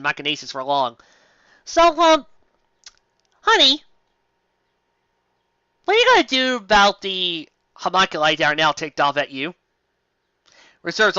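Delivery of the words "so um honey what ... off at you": mock-sweet wife. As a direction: needle him sweetly.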